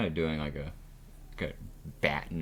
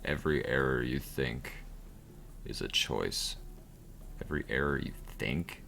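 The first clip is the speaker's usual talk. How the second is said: game narrator; 4 semitones lower, 4 dB quieter than usual. Acted. Narrator lesson.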